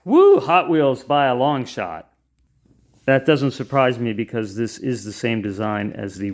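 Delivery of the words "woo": excited cheer. Real, not acted. Cheering a race result.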